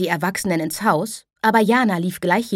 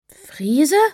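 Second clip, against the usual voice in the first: puzzled question; 8 semitones higher, 3 dB louder than usual. Acted. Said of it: "Jana war verwirrt".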